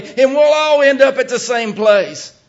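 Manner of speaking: the claim flung out loud and high; loud high mock. Shown as a demonstration. Preacher hurling the false teachers' promise at the church, loud. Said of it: "Just not where we want to go".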